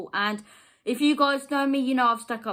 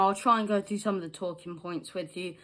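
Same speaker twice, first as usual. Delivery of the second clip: ordinary talk, nothing marked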